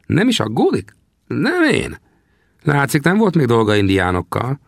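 put-on old trapper questions the young man. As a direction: play the grizzled scout, needling the greenhorn. Sam teasing.

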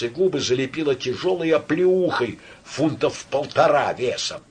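plain reading voice

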